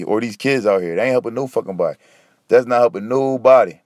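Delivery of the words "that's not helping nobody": ordinary delivery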